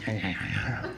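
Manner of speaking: low, very soft wolf pant; quiet string of animal huffs; wolf noises. Acted. Bloody wolf facing pilgrim, panting noises. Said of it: "He's got blood on his head".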